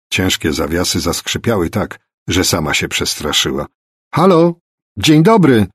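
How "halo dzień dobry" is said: loud, high repeated hail; high, carrying call into a house. A narrator's reading. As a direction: shout to get someone's attention. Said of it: "ponowiła próbę po chwili".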